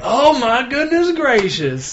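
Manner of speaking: high, drawn-out exclamation; slow, high-pitched theatrical amazement; mock astonishment. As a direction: stretch it out slowly, hammed-up and high. Acts out reaction to British actor's talk.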